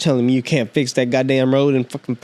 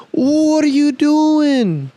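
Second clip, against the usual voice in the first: exasperated disbelief; 11 semitones higher, much slower than usual. Real, not acted. Ranting about unfixed road.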